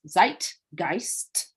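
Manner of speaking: ZEITGEIST split into two deliberate beats; "zeitgeist" with crisp emphasis on both halves; emphatic. Shown as a demonstration. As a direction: say ZEIT, then GEIST, each one stressed. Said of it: "had to say that with emphasis".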